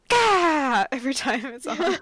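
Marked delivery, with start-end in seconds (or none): 0.1-0.9 sudden loud gah; shocked yelp. Retold or imitated. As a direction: yelp like she's startled. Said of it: "she's just like".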